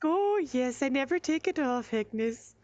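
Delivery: high, sweet innkeeper voice; high gushing hostess voice; Bertrude. Acted. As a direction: gush over the locket, high and sweet. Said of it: "She's like".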